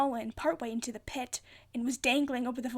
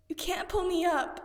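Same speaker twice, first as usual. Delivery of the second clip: desperate plea; 6 semitones higher, 3 dB louder than usual. A narrator's reading. Annabeth pleading, hanging over pit.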